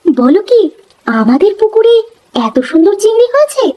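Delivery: thrilled disbelief climbing in pitch; amazed disbelief. Acted. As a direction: marvel at the news, voice soaring. Wife amazed prawns from own pond.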